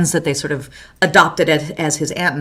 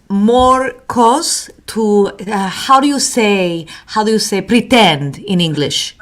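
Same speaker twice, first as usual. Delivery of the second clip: mock Spanish accent, groping for English words. Host spoofing Hilaria Baldwin to co-host, halting Spanish accent. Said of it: "I'm going to do the Spanish accent".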